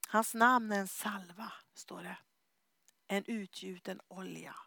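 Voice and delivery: slow hushed whisper of scripture; reverent whisper. Real, not acted. Preacher quotes the Song of Songs, slow reverent whisper. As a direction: let the verse fall in a whisper.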